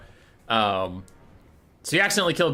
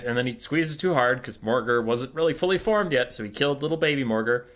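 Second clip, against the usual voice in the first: ordinary delivery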